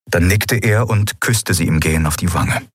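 usual speaking voice

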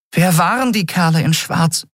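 high little voice, worried; the fairy pressing the lion-man for answers; anxious fairy. A narrator's reading. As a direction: ask it small and high.

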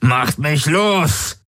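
the captive screams at them; shrieking. Acted. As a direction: scream to be freed. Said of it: "kreischte er".

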